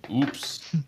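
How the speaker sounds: breathy menacing whisper; whispered oops. Acted. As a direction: whisper it like a threat. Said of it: "He says".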